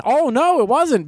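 high surprised oh no; sudden realization. Real, not acted. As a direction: catch yourself mid-story. Sudden correction.

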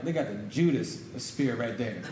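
chuckling low through the words; a laughing jab at the critics. Real, not acted.

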